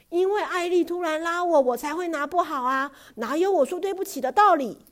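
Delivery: high, loud protesting reply; a loud, stubborn retort; indignant defence. Acted. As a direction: insist it wasn't his fault. Father defends himself in quarrel.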